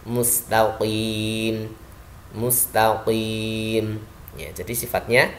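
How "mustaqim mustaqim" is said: "Mustaqim" very slow, heavy q, long final vowel; heavy qaf. Shown as a demonstration. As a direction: raise the tongue back on the q of "Mustaqim". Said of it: "lidahnya diangkat". ("Mustaqim" panjang dengan qaf tebal)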